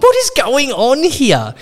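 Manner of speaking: very high, drawn-out disbelief; mock bewilderment. Patient reacting to reception's praise, high exaggerated bewilderment. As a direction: wail it slowly in exaggerated confusion.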